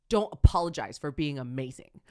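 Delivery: loud, emphatic praise from a coach; coach's loud praise. Retold or imitated. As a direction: cheer them on with a big loud voice.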